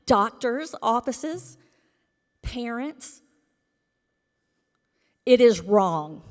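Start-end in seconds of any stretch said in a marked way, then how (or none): 5.2-6.2 much louder, hammering "It is wrong"; indignant loud declaration; emphatic outburst. Real, not acted. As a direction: slam it down like a verdict. Angry public comment.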